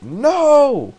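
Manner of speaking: a terrible scream of pain; anguished scream. Retold or imitated. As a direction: shriek it out in pain. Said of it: "McGonagall shouts it".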